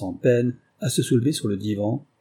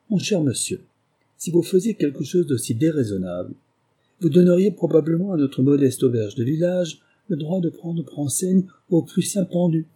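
higher, animated detective's warning; lightly voiced Holmes, mocking; 6 semitones higher than usual. Holmes warns his captive against calling for help, mockingly courteous. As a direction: warn him with mock courtesy.

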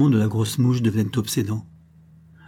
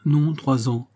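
flat, mechanical correction; automaton voice; 3 semitones higher, slower than usual. A narrator's reading. Nora corrects Miss Aldis in a detached, mechanical voice. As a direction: answer like a machine reciting. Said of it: "et aussi d'une voix d'automate".

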